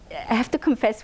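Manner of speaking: ordinary delivery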